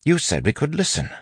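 high, complaining demand. A narrator's reading. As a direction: press the point indignantly. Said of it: "Breton demanded".

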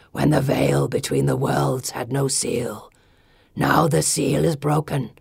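deep, slow stone guardian; solemn gargoyle warning. A narrator's reading. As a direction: warn her in a low, gravelly creature voice. Gargoyle guardian warns the girl.

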